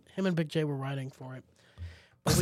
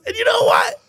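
very high, loud indignant shouting; acted fed-up audience member yelling back; outraged rant; 14 semitones higher, 10 dB louder than usual. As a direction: imitate an offended man going off. Offended man rants back at comic.

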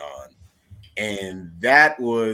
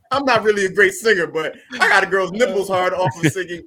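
laughing brag; 7 semitones higher, 6 dB louder than usual. Real, not acted. Laughing about his singing.

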